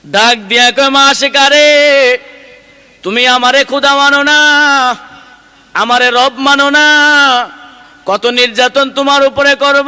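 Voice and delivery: angry Pharaoh in sermon. Acted. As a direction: thunder the threats as a preacher, stretching line endings. Preacher voices the angered Pharaoh.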